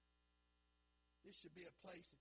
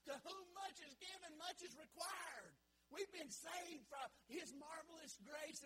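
high, loud, slow shouted preaching; preaching at full force; 8 semitones higher, 6 dB louder, slower than usual. Acted. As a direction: drive it home from the pulpit.

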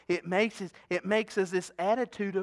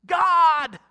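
exclaimed cry; 7 semitones higher, 10 dB louder than usual. Acted. Preacher exclaims mid-sermon.

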